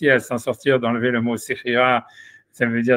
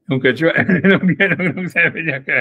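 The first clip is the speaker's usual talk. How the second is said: higher-pitched, laughing through the words; 5 semitones higher, 3 dB louder than usual. Real, not acted. Teacher losing the thread of the explanation, laughing. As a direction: giggle while trying to resume.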